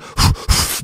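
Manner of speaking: short, forceful puffs acting out panic; hard breathing. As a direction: blow out quick, heavy breaths. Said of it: "Breathing hard".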